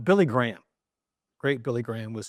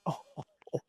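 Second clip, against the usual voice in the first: stunned gasp; 10 dB quieter, much faster than usual. Retold or imitated.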